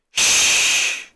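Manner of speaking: sharp, loud librarian shush. Acted. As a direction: hush the kids hard from across the room. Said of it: "Both heard a loud shh sound".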